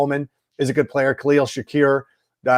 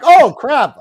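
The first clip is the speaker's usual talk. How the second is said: higher, louder sudden alarm; retold oh-no moment; mock realization; 10 semitones higher, 9 dB louder than usual. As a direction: catch yourself remembering. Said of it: "then I'm like".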